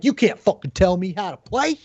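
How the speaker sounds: mocking whine that jumps high at the end; mocking a toxic player. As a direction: mimic a defensive player snapping back at advice. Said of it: "then they're like".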